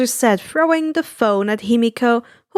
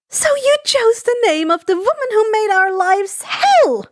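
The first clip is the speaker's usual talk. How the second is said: high, outraged character voice; exploding at him; 9 semitones higher than usual. Acted. Inko confronting the broker, high outraged outburst. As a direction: fling the accusation up high. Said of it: "Inko exploded".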